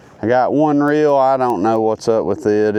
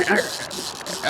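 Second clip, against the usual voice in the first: fishing whisper; 6 semitones higher, 6 dB quieter than usual. Real, not acted. Quietly on phone while fishing.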